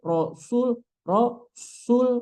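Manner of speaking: "rosul" with rounded heavy s like sod; wrong pouted rosul. Shown as a demonstration. Teacher mimicking a wrong rasul. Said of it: "langsung monyong, jadi sod".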